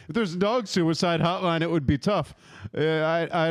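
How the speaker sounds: ordinary delivery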